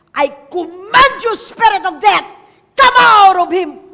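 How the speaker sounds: thunderous command to leave; commanding exorcism shout. Retold or imitated. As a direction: cast the spirit out with full force. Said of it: "command that spirit of death to go".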